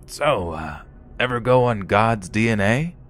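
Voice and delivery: imitated raspy older man. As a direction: put on Joe's rasp for a probing question. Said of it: "asking me in a raspy voice".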